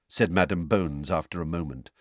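ordinary delivery, nothing marked

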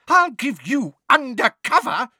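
loud, high-pitched outburst; outraged cry. A narrator's reading. Neighbour rounding on Mundungus, outraged cry. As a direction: cry it out in fury. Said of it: "cried Missus Figg".

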